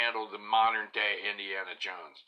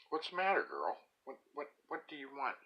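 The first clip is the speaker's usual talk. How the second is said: softer coaxing words to the dog; pet talk; 5 semitones higher, 6 dB quieter than usual. Real, not acted. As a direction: coo at the dog quietly. Talking to the whining dog.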